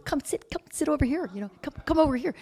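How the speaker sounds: high, soft, eager beckoning; mock-sweet, insistent calling to a new best friend; imitated clingy invitation. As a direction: coax them over in a small high voice.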